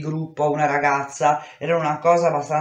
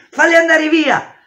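desperate scream; 12 semitones higher, 8 dB louder than usual. Acted. She screams at the boys.

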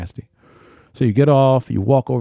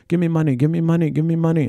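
imitated beggar; 7 semitones higher than usual. Beggars pestering at Sphinx.

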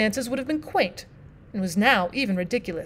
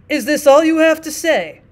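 judge, impatient and louder; 5 semitones higher, 8 dB louder than usual. Acted. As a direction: cut him short, impatient. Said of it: "asked the judge impatiently".

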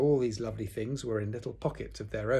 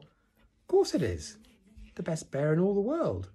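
proud enthusiasm; 8 semitones higher, 4 dB louder than usual. A narrator's reading. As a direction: proclaim it with delight. Christopher Robin proudly presents gift.

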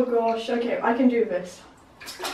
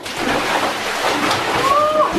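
sharp gasp; 11 semitones higher than usual. Real, not acted. Jumping into ice water.

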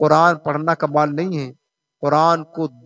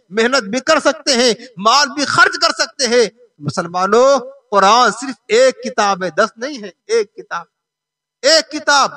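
insistent raised voice, driving list; full-force preaching; 6 semitones higher, 4 dB louder than usual. Real, not acted. Preacher contrasting schoolbooks with one Quran, impassioned sermon. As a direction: pile up each point like a fired-up preacher.

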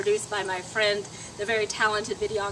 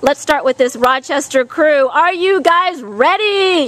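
loud call to a crowd; shouting; 2 semitones higher, 13 dB louder than usual. Real, not acted. Shouting challenge to kids outside.

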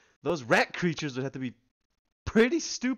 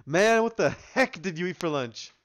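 ordinary talk

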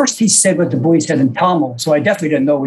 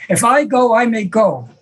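mildly louder, higher insistence; a touch louder the second time; louder repeat; 7 semitones higher than usual. Retold or imitated. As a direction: say it again, pressing harder. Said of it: "He says it again louder".

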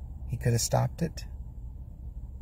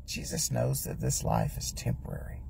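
softer, near-whispered reflection; hushed, thoughtful aside to the congregation; 7 dB quieter than usual. Acted. Preacher drops to a hush.

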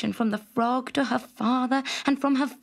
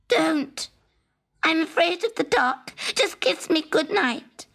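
frightened frog; 6 semitones higher than usual. A narrator's reading. Frog afraid of dark.

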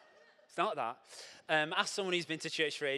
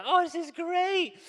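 high, slow drawn-out delight; mock delight; 11 semitones higher, 8 dB louder, slower than usual. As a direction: overdo the happy gasp. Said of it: "Usually people go".

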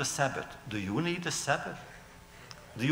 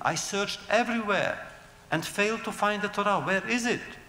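higher, pressing complaint; crying out; 7 semitones higher than usual. A narrator's reading. Satan to God, a frustrated pleading cry. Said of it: "cried Satan".

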